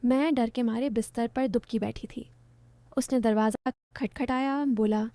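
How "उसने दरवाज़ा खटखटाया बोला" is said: plain reading voice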